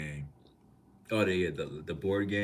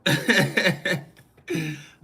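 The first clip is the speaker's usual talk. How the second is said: loud laugh; 12 semitones higher, 14 dB louder than usual. Real, not acted. Streamer laughing at the story about his neighbors, a loud high laugh.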